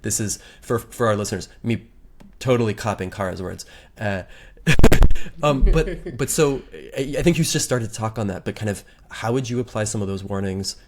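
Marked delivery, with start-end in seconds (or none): 4.3-5.4 sudden loud unvoiced laugh; loud breathy laugh. Real, not acted. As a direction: burst out laughing, embarrassed. Laughs at copying guest's words.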